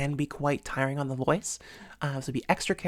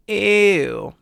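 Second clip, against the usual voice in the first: loud, higher, thick "ew"; heavy vocal weight, disgusted; emphatic ew; 5 semitones higher, 11 dB louder than usual. Shown as a demonstration. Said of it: "emphatic ew sound".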